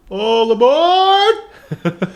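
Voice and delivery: high, drawn-out all aboard; train conductor call. Pretend. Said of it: "slide whistle train".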